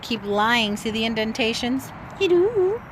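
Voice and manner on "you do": high, louder teasing at the pet; playful scold. Real, not acted.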